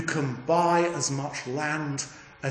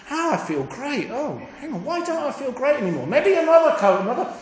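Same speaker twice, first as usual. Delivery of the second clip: mock shopper's craving; 8 semitones higher than usual. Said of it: "Another coat and another pair of shoes".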